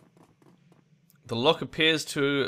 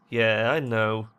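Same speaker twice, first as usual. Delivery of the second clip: crumbling resignation; 2 semitones lower, much slower than usual. Acted. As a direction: concede slowly as the brave front cracks. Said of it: "facade cracks and Mira's face crumples".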